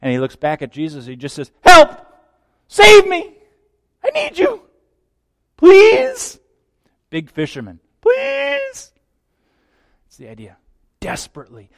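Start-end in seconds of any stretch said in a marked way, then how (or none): 1.7-6.4 very high, loud panicked shouts; high frantic yells for help; desperate cry for help. Acted. Peter sinking in waves. 8.0-8.9 very high, thin desperate cry; high urgent please. Acted. Peter's desperate plea repeated.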